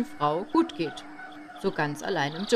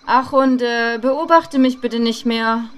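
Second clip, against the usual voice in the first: loud, slow call after him; loud call, stretched out; 4 semitones higher, 9 dB louder than usual. Retold or imitated. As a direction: shout it after someone leaving. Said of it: "rief ich ihm noch nach".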